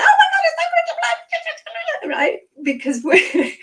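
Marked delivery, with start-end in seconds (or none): 0.0-2.2 very high excited squeal; gushing excitement. Acted. Two friends getting together, squealing with excitement. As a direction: gush wildly, hammed-up excitement. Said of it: "a state in each other that's full of excitement".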